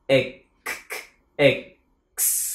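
"Ex-, ex-, X" with separate k clicks, then a long hissed s; k sound kept. Shown as a demonstration. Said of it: "Mình đừng có bỏ âm cờ". ("Ex-, ex-, X" giữ rõ âm cờ rồi sờ)

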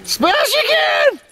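very high, slow squealed call; drawn-out shriek. Pretend. Calling to smash again in a drawn-out shriek. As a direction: stretch the words into a high shriek.

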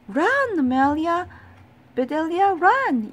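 high, loud urging yell. A narrator's reading. Boys urging the batter to run, excited high yell. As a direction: yell to send the runner off. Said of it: "yelled the boys".

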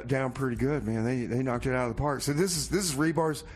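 normal talking voice

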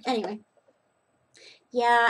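ordinary talk

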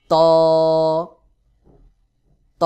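ত as a heavy, rounded Arabic taa, held long; pressed, hard articulation. Shown as a demonstration. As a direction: press the tongue tip and sound ত hard. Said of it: "একটু চাপ দিয়ে উচ্চারণ". (চাপ দিয়ে শক্ত করে ত)

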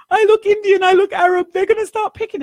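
high, pleading, frightened voice; imitating her fear. Frightened woman fearing attacks after nine eleven, imitated fear. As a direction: let her panic rise high and loud. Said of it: "She says".

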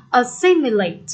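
"Assimilate" with a clear, full -SIM-; stress on second syllable. Shown as a demonstration. Teacher demonstrating the stress rule, stress on second syllable. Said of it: "Âm nhận dấu nhấn sẽ đọc to và rõ hơn".

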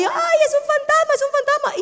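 high, panicky mock wail; frightened cry. Disciples watching Jesus pass by, frightened cry. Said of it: "comenzaron a gritar".

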